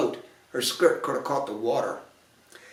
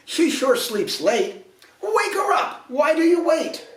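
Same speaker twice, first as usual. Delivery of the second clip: a prince who wants it done now, pitched high; impatient prince; 12 semitones higher than usual. A narrator's reading. Prince to the fairies, high impatient demand. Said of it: "he frowned".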